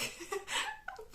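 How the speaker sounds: high, disbelieving laugh. Real, not acted. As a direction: crack up at the absurdity. Laughs after "the fuck.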